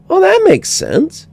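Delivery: put-on very high child's voice; a kid satisfied with the answer; little girl. A narrator's reading. Child agreeing.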